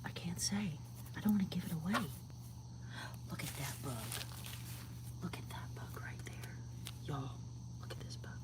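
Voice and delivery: soft, breathy hush; whispering. Real, not acted. Showing viewers bugs at night, whispered. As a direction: hush so you don't disturb the bugs.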